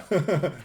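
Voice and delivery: a quick high laugh at his own provocation; amused chuckle breaking out; short burst of laughter. Real, not acted. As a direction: laugh it off, loud and sudden. Sardonic aside after provocative claim.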